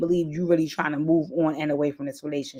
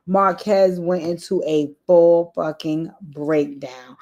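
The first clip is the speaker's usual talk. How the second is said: slow emphasis; slower than usual. Real, not acted. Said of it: "slow emphatic recap".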